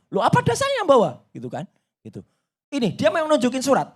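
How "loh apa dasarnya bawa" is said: high-pitched imitated protest; istri membantah. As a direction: play a wife challenging at once. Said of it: "Istri saya langsung bilang". (meniru istri membantah, nada tinggi)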